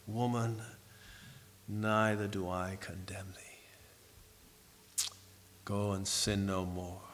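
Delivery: gently forgive her, barely above a breath; soft and tender. Retold or imitated. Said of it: "were soft and so tender".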